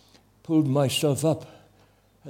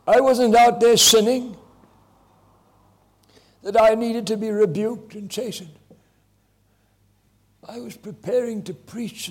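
anguished prayer; 9 semitones higher than usual. Retold or imitated.

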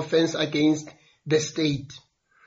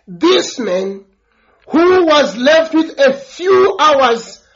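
rising preaching fervour; 4 semitones higher than usual. Acted. Preacher stressing grace to the congregation, rising fervour. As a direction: drive the point home to the congregation. Preacher builds to full force.

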